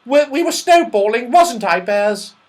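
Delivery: put-on very high and loud little girl; a cheeky little girl denying it; little Goldilocks. A narrator's reading. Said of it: "said Goldilocks".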